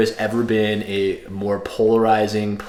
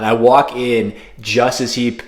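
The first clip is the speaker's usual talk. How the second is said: usual speaking voice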